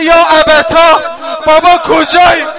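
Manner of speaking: loud wailing call for father; loud tearful cry of a lost little girl; child crying for father. Acted. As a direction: wail for a missing father.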